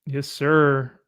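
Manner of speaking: usual speaking voice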